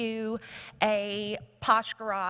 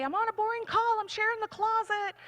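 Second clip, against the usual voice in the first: mock husband yell; 11 semitones higher than usual. Husband yelling down the stairs from a boring call, high mock yell. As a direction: pitch it way up like a husband calling down. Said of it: "My husband will yell down the stairs".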